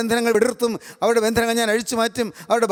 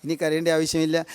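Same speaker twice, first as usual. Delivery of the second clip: preacher comforting a weeping listener, softly reassuring; gentle comfort; 7 semitones lower, 4 dB quieter than usual. Acted. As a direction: lower and softer, soothing.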